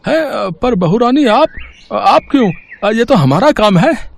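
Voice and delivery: high, loud, hesitant cook voice; flustered cook. Acted. Cook stammering at the bride taking his job. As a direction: fumble the protest nervously.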